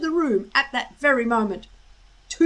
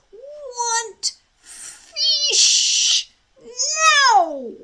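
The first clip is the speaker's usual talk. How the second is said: high, drawn-out creature voice; demanding dragon; 6 dB louder, much slower than usual. A narrator's reading. Dragon demanding fish from Hiccup, high and slow. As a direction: stretch each word like a greedy little dragon.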